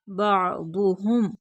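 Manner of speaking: बादुहुम slow, throat catch after बा and heavy दु; throat ain, full daad. Shown as a demonstration. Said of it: "दौत पुर पढ़ेंगे". (बादुहुम, हलक से आइन, पुर दौत)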